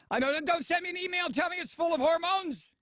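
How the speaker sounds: very high-pitched rattled-off plea; head off the complaints in a high, strained voice; mock exasperated protest. Heading off listeners' complaints loudly.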